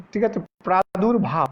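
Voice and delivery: ordinary talk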